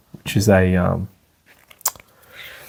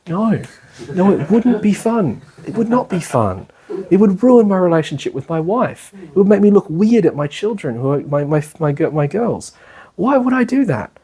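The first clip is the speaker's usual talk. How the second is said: much higher, worked-up protest; indignant refusal; 8 semitones higher than usual. Pretend. Teacher acting out refusing temptation to protect his wife and girls, much higher and indignant. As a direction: protest in mock outrage.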